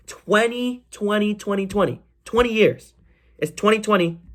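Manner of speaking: high, marveling repeats of twenty; awed chant of twenty; amazed repetition. Real, not acted. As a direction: keep saying twenty in disbelief.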